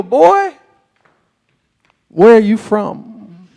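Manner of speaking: very loud, drawn-out demand; mock Texan challenge. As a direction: imitate a blustering local sizing up a stranger. Acting out Texans confronting a newcomer.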